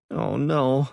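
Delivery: dismayed. A narrator's reading. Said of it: "Savannah said".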